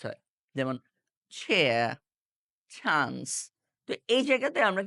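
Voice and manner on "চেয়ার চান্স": চেয়ার and চান্স opened with a puff of air, ছ sound; aspirated ch. Shown as a demonstration. Teacher models English words starting with ch, aspirated ch. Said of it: "চ যুক্ত ছ দিয়ে বলতে হবে".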